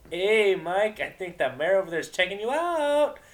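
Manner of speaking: high, loud teasing mare voice; snickering Vinyl. Acted. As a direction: tease him, snicker it out. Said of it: "she snickered".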